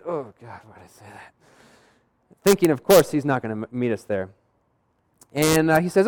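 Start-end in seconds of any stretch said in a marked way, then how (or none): 0.1-1.3 hushed, sinking inner groan; regretful aside. Retold or imitated. Regretting the invitation he just blurted, hushed. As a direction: drop right down as if muttering to yourself.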